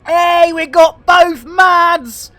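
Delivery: slow, loud, high delighted shout; excited shout. Real, not acted. Streamer cheers as two viewers join, shouting gleefully.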